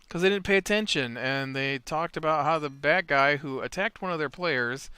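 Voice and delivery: ordinary talk, nothing marked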